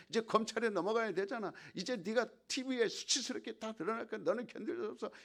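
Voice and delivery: high, relentless taunting voice; accusing devil. Acted. Preacher voicing the devil pushing the actor toward despair, high relentless taunting. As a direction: pile on the shame line after line. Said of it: "마귀가 공격하는 거야".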